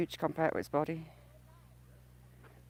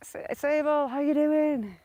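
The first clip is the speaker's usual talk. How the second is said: cooing greeting; 6 semitones higher than usual. Real, not acted. Greeting a duck by name.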